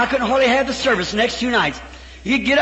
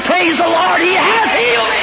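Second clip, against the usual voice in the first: joyful shouting; 4 semitones higher than usual. Retold or imitated. Old man running from the barber shop, joyful shout. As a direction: cry it out running down the street. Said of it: "screaming and shouting".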